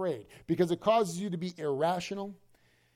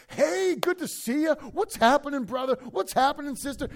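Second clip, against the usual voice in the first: cheery church greeter; 8 semitones higher, 3 dB louder than usual. Retold or imitated. Preacher voicing happy church members greeting newcomers, high and beaming. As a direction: greet everyone like an overjoyed churchgoer.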